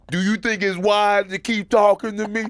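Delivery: high, loud, hammed-up interviewee voice; mock tough-guy guest. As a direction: puff up like a guest lecturing the host. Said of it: "and then he goes".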